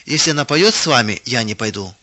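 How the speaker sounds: ordinary delivery, nothing marked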